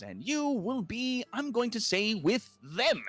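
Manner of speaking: high, slow fussy clerk; clerk Benjamin. Acted. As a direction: sort them out like a finicky official. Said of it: "he goes".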